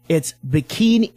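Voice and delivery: slow, deliberate "the Bikini Car Wash Company"; careful correction. Shown as a demonstration. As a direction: spell out "Company" slowly for John. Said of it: "I made a mistake".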